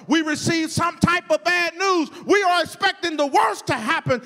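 emphatic, driving sermon cadence; full-force preaching. Real, not acted. Preacher telling congregation not to be afraid, mounting forceful preaching.

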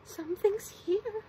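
high, slow, wary realisation; alarmed imp. A narrator's reading. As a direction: let the voice climb with alarm. Scared imp thinks someone is inside.

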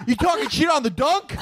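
high-pitched comic challenge; mock indignant challenge. Host mock-challenging someone over the dunk, high indignant voice. Acted outburst in a comic bit.

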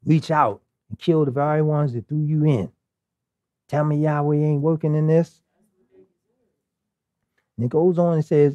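usual speaking voice

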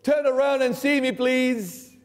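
Jesus calling to the deaf one from behind. Retold or imitated. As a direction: call out to get his attention. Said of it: "He cr-, yells at you".

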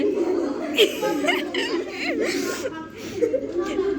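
high, drawn-out laughter; long giggling fit. Real, not acted. Laughing with friend.

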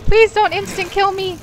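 high, loud desperate pleading; panicked pleading screams. Real, not acted. Streamer begging the monster not to kill him, screaming. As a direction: beg and scream in real panic.

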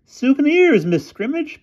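very high piping falsetto. A narrator's reading. Disguised boy answers the headmistress, piping falsetto. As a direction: pipe it up loudly as a fake girl. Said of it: "piped Bruno falsetto".

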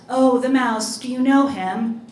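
perked up and loud; sudden recollection. A narrator's reading. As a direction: burst out as the thread comes back. Miss Birdie suddenly remembers the mouse.

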